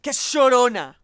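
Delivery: indignant Colombian voice; Colombian accent. Acted. Colombian arguing it is theirs, indignant Colombian accent. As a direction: push back like an offended Colombian. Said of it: "every country has their own version".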